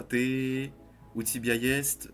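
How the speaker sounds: normal talking voice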